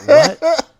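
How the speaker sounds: high, loud startled what; girl's high shocked cry of what. Retold or imitated. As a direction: yelp it out in shock. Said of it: "And she went".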